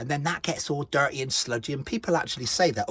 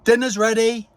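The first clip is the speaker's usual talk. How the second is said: loud, high dinner call; yelling across the room; 8 semitones higher, 6 dB louder than usual. Real, not acted. Calling Jackie to dinner.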